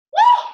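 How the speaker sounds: high, loud celebratory whoop; triumphant woo after the last rep; excited woo. Real, not acted. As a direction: whoop like you just crushed a set. Whooping after finishing the set.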